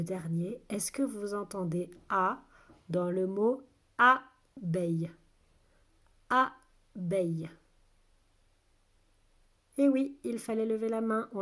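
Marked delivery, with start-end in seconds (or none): 4.0-7.5 two well-separated syllables. Shown as a demonstration. Teacher repeats the word for listening children, syllable by syllable. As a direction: sound it out with a gap between beats.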